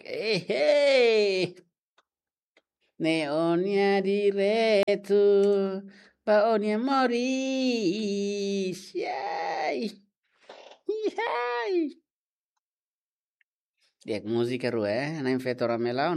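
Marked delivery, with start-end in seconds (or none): 0.1-12.0 festive cry. Acted. Cries out during the song.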